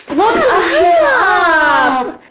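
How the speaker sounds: high, loud call to look up. Real, not acted. Calling out to sister.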